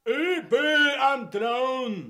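slow, loud shout of "Übel am Thron"; repeating news loudly to someone hard of hearing. Acted. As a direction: shout it again slowly for a listener who misheard. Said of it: "Was ist mit dem Brunnen?".

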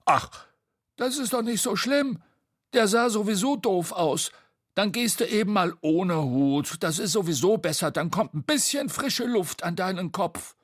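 high, breezy character voice; Bo, dismissive. Acted. Bo playing down Tete's broken hat, breezy. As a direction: brush it off in a high, chatty voice.

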